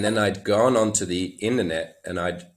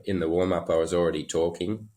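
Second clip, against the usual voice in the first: usual speaking voice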